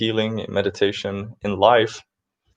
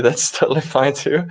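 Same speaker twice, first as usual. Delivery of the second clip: light chuckle in the voice, real amusement; amused, laughing; 6 semitones higher, 4 dB louder than usual. Real, not acted. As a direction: laugh through it, pitched higher. Joking that Pepsi is allowed.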